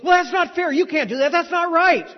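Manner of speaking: loud, high-pitched acted outburst; outraged student. Student objecting to the professor's unfair reason, outraged. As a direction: protest hotly, imitating a wronged student. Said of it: "was really mad at the professor".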